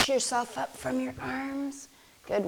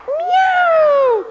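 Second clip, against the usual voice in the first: high, loud falling meow; scared cat meow; 11 dB louder than usual. Pretend. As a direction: squeal it like a frightened kitten. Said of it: "And then it's a scared cat".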